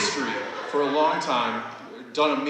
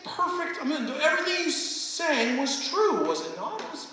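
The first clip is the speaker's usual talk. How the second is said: thrilled praise; 13 semitones higher than usual. Real, not acted. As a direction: gush over the performance in real awe. Host reacts to opera singing.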